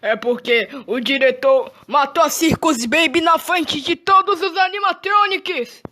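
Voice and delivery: urgent breathless news; alarmed telling. Real, not acted. Player tells Bonnie the director killed Circus Baby, alarmed. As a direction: voice climbing high on todos.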